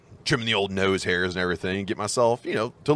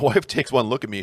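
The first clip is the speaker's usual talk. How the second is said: ordinary delivery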